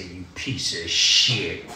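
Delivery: low, slow, bitter retold insult; contemptuous put-down. Father to addicted son at the door, low slow contempt. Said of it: "this is exactly how I said it to him".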